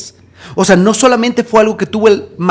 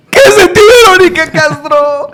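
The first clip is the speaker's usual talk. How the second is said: very high, wailing outburst; frantic question; 10 semitones higher, 5 dB louder than usual. Retold or imitated. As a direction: burst out with it, stretching the name. Second take, escalated to wail.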